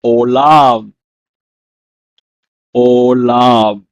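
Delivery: olam said "oh-lahm" twice, firm; "Olam" said twice, strong and falling; Hebrew word. Shown as a demonstration. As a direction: model "olam" then repeat. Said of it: "It's pronounced in Hebrew as".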